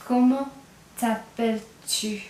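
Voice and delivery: "Comment" then a pause, then "t'appelles-tu?" slowly; split slow demo. Shown as a demonstration. Teacher to class, splitting the informal question slowly. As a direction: break it into two slow chunks. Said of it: "you'll hear that "tu" at the end".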